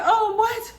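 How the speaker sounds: high-pitched imitation of alarm; breathless high disbelief; shocked mother. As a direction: react aghast, voice shooting up. Voices mom's shocked reaction.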